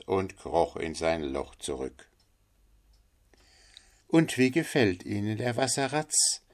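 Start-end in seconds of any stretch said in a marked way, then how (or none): 4.1-6.4 sociable duck. Acted. Figure of the duck.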